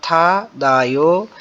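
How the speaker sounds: था दा यो, each syllable slow and separate; slow syllable demo. Shown as a demonstration. Teacher to students, slowly sounding out the Korean word. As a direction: spell out था दा यो slowly for the class. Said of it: "आप सुनेंगे".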